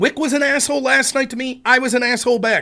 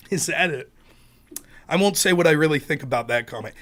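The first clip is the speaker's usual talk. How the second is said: ordinary talk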